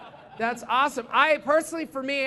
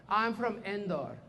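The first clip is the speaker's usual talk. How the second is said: slow imitated Indian accent; careful, softer mock Indian accent; Indian student; 3 semitones lower, 8 dB quieter, slower than usual. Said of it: "students from India".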